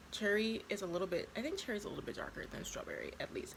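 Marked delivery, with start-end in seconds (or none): 1.4-3.5 whispering. Real, not acted. Whispered comparison.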